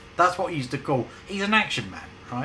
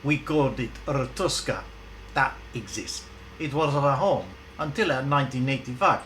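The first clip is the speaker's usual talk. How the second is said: lightly voiced Russian woman, husky; a hint of a Siberian accent; 2 semitones higher than usual. Ivana telling Benny about her village, mild Russian accent. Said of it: "Her voice was not as deep as that".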